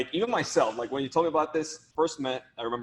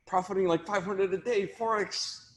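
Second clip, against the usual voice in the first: mock scam ad; 6 semitones higher than usual. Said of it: "I get so many Instagram D Ms".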